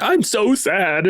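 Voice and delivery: high, slow, whiny fake sob; mock sobbing. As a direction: ham up a weepy self-pitying whimper. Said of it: "sobbing in a corner and saying".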